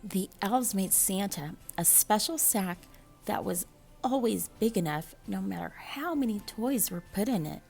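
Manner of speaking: plain reading voice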